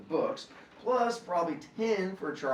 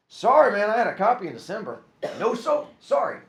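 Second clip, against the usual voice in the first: high, loud, fast brush-off; brusque clerks; 8 semitones higher, 10 dB louder than usual. A narrator's reading. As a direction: dismiss the caller quickly.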